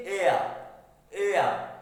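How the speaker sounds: एयर as a gliding "ay-a"; pronunciation demo. Shown as a demonstration. Teacher answering how to pronounce A, falling gliding एयर. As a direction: sound out एयर separately, falling at the end. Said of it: "इसको हम कैसे pronounce करेंगे".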